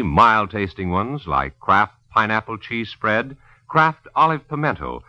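usual speaking voice

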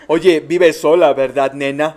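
laughing mock creepy voice; sly predator. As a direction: lure her in slowly. [name] acts the killer.

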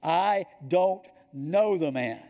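loud, slow, word-by-word insistence; sworn denial. Acted. Peter swears to his accusers, slow and forceful. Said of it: "and he swore to them".

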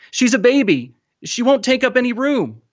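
high, urgent appeal; begging. A narrator's reading. Boy begging boat people to take his sister, pleading. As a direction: press the plea, keeping the pitch high. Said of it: "Mahmoud begged".

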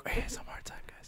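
playful hushed whisper; mock ASMR whisper. Co-host joking about an ASMR corner, mock whisper. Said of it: "A S M R corner".

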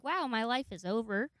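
ordinary talk

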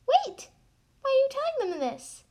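high, loud protest; alarmed objection. Acted. Co-narrator objects to spoiling the story, loud and high. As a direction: cut in sharply, high and loud.

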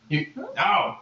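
very high goblin surprise; a startled friendly goblin; goblin greeting. Acted.

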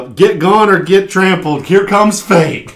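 slow, high, booming proclamation; magically amplified voice. Retold or imitated. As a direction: boom it like a magic herald. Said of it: "three times louder".

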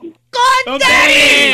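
drawn-out shout. Pretend. Catchphrase yelled in banter.

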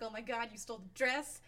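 mock shock. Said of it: "and they're like".

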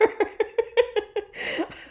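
high, helpless real laugh; long cracking-up laugh, too far gone for words; laughing fit. Real, not acted. Reviewer cracking up at co-host's big kick, helpless laughing. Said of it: "I'm laughing".

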